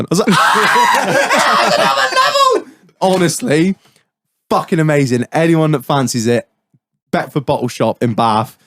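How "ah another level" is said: high, laughing mock shout; retold gleeful outburst. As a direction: cry out in laughing admiration. Retold reaction to the prank reveal.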